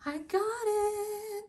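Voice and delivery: very high, drawn-out singsong; excited celebration. Real, not acted. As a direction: stretch it out high and gleeful. Celebrates long-awaited period arriving.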